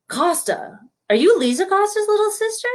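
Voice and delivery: high-pitched roll-call question; gym teacher. Retold or imitated. Imitates gym teacher Miss Wassman.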